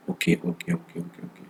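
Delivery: whispered okays under his breath; trailing whispered okay, okay; muttering to himself. Real, not acted. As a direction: think aloud in a whisper, barely audible. Muttering to himself while checking.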